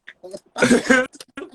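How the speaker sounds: high squealing laugh. Real, not acted. Retelling the judge's praise, laughing high. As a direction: burst out laughing.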